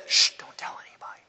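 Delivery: fast whispered shush; hushing whisper. Retold or imitated. Jesus again hushing the crowd, fast whisper. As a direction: hush them, keep the secret. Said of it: "And then he says".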